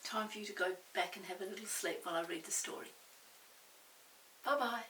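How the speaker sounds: usual speaking voice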